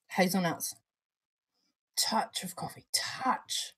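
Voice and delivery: soft unvoiced whisper. Real, not acted. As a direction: breathe the tasting note out. Whispered tasting notes.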